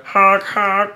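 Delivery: high honking noise; imitated horn honk. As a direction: honk like a street vendor.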